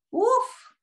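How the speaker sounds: high, exaggerated "uf" of relief; storybook grandpa's relieved puff; sigh of relief. A narrator's reading. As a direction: let out an acted phew. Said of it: "suspiró el abuelo".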